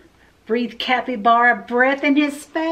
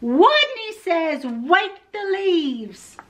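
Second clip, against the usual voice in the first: slow, high called-out command; stronger voice; 6 semitones higher, slower than usual. A narrator's reading. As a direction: stretch the order out as a high call. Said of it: "Wadney's voice was stronger".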